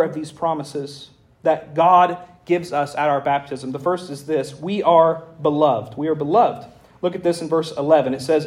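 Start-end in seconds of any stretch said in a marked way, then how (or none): 4.6-6.0 slow, weighty statement; slow emphasis. Shown as a demonstration. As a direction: lean on each word of beloved. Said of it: "repeats line for emphasis".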